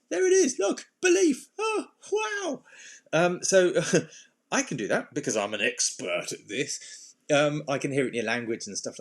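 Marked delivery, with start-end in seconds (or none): 0.1-2.6 much higher, playful mock wonder at a find; hammed-up delighted discovery, much higher; mock amazement. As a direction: squeak it out like spotting something tiny and precious. Mock discovery of belief inside head.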